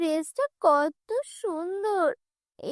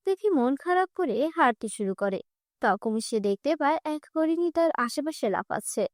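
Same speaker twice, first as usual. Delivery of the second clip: ordinary delivery, nothing marked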